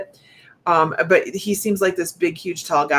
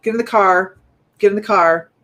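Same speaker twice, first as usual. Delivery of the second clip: mock order; 4 semitones higher, 8 dB louder than usual. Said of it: "somebody he told".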